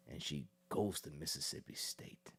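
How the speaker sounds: whispered, hushed storytelling; hushed aside. Real, not acted. Telling the audience a juicy detail, in a whisper. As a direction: drop to a whisper for the gossip.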